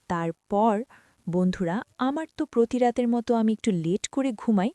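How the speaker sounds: plain reading voice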